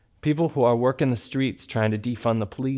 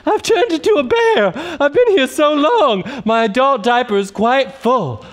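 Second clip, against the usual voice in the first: loud, high showman's welcome; campy host voice; 13 semitones higher, 7 dB louder, slower than usual. Acted. Host thanking performers in a big, high, loud camp voice. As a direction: belt it out like a drag host.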